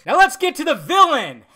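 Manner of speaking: loud, high hammed-up proclamation; mock-dramatic announcement. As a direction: belt it out like a showy announcer. Dramatic announcement of next topic.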